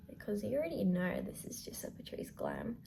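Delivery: soft breathy whisper aside. Real, not acted. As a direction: whisper it to the camera.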